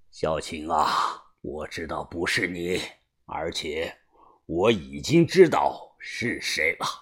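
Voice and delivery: low, slow, soft leader's voice; grave gang boss. A narrator's reading. Boss clears the accused aunt, low and grave. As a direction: speak with quiet, deliberate authority.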